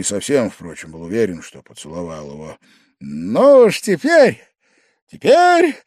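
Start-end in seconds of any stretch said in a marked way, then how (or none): 3.0-5.8 high, loud cry climbing higher; drunken frenzy. A narrator's reading. Guest after the kiss, shouting in drunken frenzy. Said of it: "в пьяном исступлении крикнул Павел Павлович".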